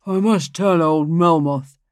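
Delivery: a self-important lawyer making a suggestion; pompous lawyer. Acted. Lawyer speaks.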